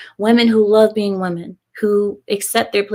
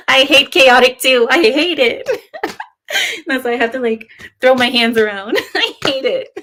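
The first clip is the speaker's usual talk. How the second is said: laughing mock hate; 4 semitones higher than usual. Real, not acted. Streamer laughs at chaotic's sandwich joke, giggly mock outrage. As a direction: laugh through a pretend outrage.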